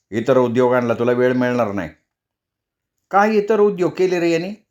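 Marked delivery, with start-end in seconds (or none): 3.1-4.6 Mai's voice, pitched up high; a mother's sharp question, voiced higher. A narrator's reading. Mother questioning her son. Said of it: "माईने विचारलं".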